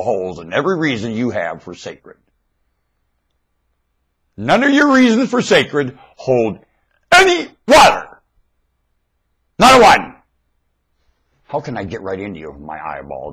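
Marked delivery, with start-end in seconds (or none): 4.4-10.2 loud, high preaching at full force; fiery preaching. Acted. Preacher challenging his listeners, full-force loud preaching. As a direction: hammer it home like a preacher on fire.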